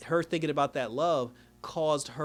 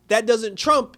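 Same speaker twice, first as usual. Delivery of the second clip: higher, louder mock protest; indignant outburst; 8 semitones higher, 10 dB louder, slower than usual. Retelling Iris protesting to Cecile, indignant and loud. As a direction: blurt it out, indignant.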